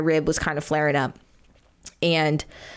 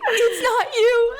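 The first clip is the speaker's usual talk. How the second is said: squeaky high laugh breaking through the words, louder; laughing too hard to talk; 3 dB louder, much slower than usual. Real, not acted. Retelling her breakdown to the co-host, real helpless laughter. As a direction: barely get the words out for laughing. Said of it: "I can't even talk".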